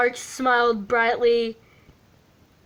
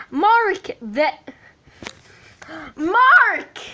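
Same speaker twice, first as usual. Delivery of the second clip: high loud call, louder the second time; 6 semitones higher, 4 dB louder, slower than usual. Acted. Sean calling Mark louder, high call. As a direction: call his name louder. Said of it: "they called louder".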